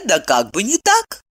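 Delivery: usual speaking voice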